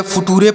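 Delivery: "फुटूरे" sounded out as fu-too-re; beginner's misreading. Shown as a demonstration. Teacher quoting how beginners read future, misread "फुटूरे". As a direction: say "फुटूरे" with every letter voiced, ending in "रे". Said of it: "ऐसा आपको नहीं पढ़ना है".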